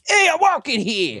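very high, hammed-up New York accent. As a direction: holler like a cartoon New Yorker. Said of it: "any voice in the world".

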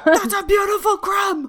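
high, loud pretend shout; mock yelling at TV. Teasing co-host imitating a baking-show fan, mock yelling. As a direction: yell praise at the screen. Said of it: "Do you yell at the screen?".

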